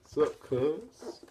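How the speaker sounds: put-on casual greeting, lifted high; playful mock greeting. Retelling Princess's oddball greeting.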